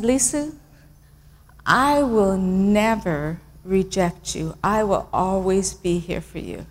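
low, slow, very soft promise; hushed reassurance to an upset child; softly, solemnly, with long pauses; tender whispering, retold. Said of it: "And I keep whispering".

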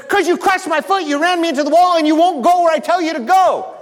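high, loud indignant shout; angry Balaam. A narrator's reading. Balaam yelling at his donkey, high and loud. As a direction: yell back at the donkey in outrage. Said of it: "And Balaam says".

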